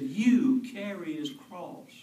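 loud, slow barked command; soldier's bark. Acted. Soldier's order in a sermon.

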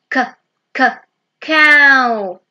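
k, k, cow with the k sound tapped out twice, then cow said loudly; teacher sounding out cow for kids to copy. Shown as a demonstration. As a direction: break the word cow into k, k, cow for children.

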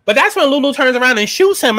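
ordinary talk, nothing marked

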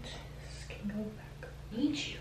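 hushed whisper to herself. Real, not acted. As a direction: whisper it under your breath. Talking to scissors, nervous.

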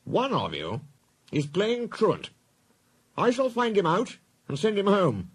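high, commanding boss voice; stern authority, pitched well up; stern Fat Controller. Acted. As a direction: lay down the law as the railway chief.

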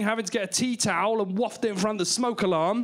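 normal talking voice

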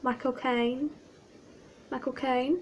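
softly tried British accent; British accent attempt. Shown as a demonstration. Testing the actor's name on viewers, shaky British accent. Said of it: "Can't do a British accent".

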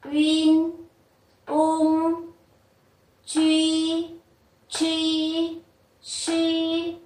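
each syllable held on a high level tone; drawn-out syllables for students to repeat; model syllable drill. Shown as a demonstration. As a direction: chant them slowly, one by one, evenly pitched. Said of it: "Các bạn đọc lại một lần nhá".

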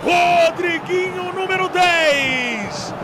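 slow, stretched, high celebration yell; explosive goal commentary. Real, not acted. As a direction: drag out the scorer's name. Second goal celebration call.

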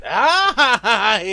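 loud, high burst of laughter; high, loud laughing fit. Real, not acted. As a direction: burst out in a big cackling laugh. Laughs out loud while playing.

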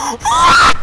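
squeaky high shout of disbelief; shocked what. Real, not acted. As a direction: shriek it in disbelief. Landed a backflip.

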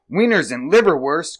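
high, loud exclamation; excited cry. A narrator's reading. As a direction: exclaim it with relish. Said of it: "cried the wolf".

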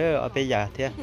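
normal talking voice

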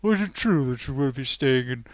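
lightly hammed-up spluttering fop; hint of a bumbling leading man; mock Hugh Grant stammer. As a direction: stammer out the question like a flustered Englishman. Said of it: "Hugh Grant says".

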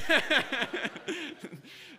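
loud high laugh. Real, not acted. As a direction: laugh through the end of it. Laughing at the story.

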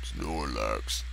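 low, soft creature growl of its name; deep Pokemon voice. Acted. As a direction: say it like the Pokemon itself.

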